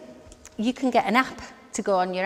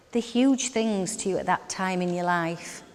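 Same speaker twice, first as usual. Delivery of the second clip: usual speaking voice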